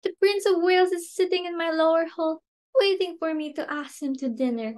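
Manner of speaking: thrilled. Acted. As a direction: marvel at it, thrilled. Thrilled the prince is downstairs.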